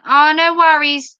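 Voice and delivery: normal talking voice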